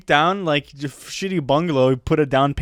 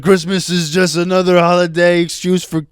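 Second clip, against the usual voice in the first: loud, mocking Arabic accent dad; 4 semitones higher, 8 dB louder than usual. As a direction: put on a thick Arabic accent. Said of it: "In an Arabic accent".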